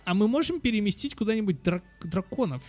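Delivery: ordinary talk, nothing marked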